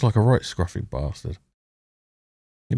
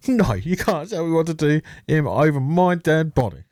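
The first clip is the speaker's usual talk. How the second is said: put-on defiant whiny teen; 8 semitones higher than usual. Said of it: "And he's like".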